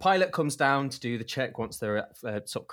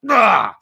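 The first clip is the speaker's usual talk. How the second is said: loud, higher angry grunt; furious groan; 8 semitones higher, 15 dB louder than usual. Retold or imitated.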